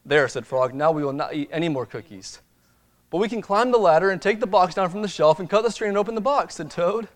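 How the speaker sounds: lively storybook reading; reading to children. Acted. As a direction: read it like a bedtime picture book. Reads storybook to congregation.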